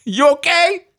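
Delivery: high, laughing put-on read; mock boyfriend texter. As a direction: crack up while playing the crude texter. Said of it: "I'll be him".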